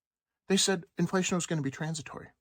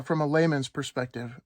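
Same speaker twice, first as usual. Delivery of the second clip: usual speaking voice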